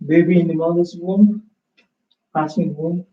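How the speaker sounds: yoga teacher guiding the final rest; guided relaxation. Acted. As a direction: ease the class out of rest, unhurried. Leads closing relaxation.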